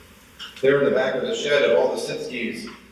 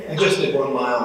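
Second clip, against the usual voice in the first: usual speaking voice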